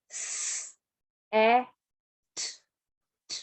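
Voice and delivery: "s" hissed without voice, then "a", "t"; sounding out letters. Shown as a demonstration. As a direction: sound out each letter slowly. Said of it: "you have learned three sounds".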